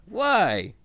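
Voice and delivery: high-pitched incredulous question; amazement. A narrator's reading. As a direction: ask it in blank amazement. Hans amazed at Puke.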